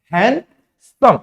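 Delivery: हैंडसम slowly, "han... sum", no D; silent D, syllable by syllable. Shown as a demonstration. Teacher repeats slowly for students, silent D in हैंडसम. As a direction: stretch हैंडसम in two slow parts without D. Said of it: "इसको हम क्या पढ़ते हैं?".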